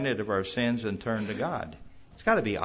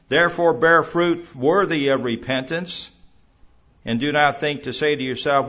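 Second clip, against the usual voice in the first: loud, raised-voice denunciation of hypocrites; forceful rebuke; 4 semitones higher, 8 dB louder than usual. Acted. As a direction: thunder it out like a prophet rebuking the crowd. John the Baptist rebuking the Pharisees.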